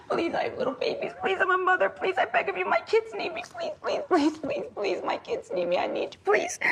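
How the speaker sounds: high, breathy begging for her life; retold pleading. Victim reliving her plea to the gunman, high and desperate. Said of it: "hunched over, sobbing, going".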